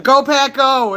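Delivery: high, loud team chant; imitated kid's cheer. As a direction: mimic a kid calling from a passing car. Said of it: "a kid who was in a car".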